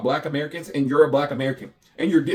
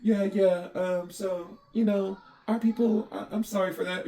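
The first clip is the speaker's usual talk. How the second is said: hesitant, higher-pitched imitation of her; imitated apologetic girl; 6 semitones higher, slower than usual.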